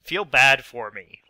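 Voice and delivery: put-on milk the sympathy like a sick kid; mock pleading. Sick gamer begging viewers for sympathy, mock whiny. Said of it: "please don't make fun of me".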